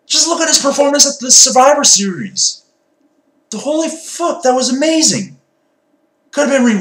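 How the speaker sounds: high, amazed gush; excited gushing. Real, not acted.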